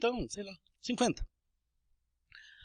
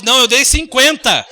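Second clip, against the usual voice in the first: louder, insistent "Não, eu dei cinquenta"; raised voice; 2 semitones higher, 11 dB louder than usual. Acted. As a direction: raise your voice over the change. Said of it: "você sobe o tom de voz".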